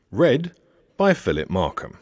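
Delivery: plain reading voice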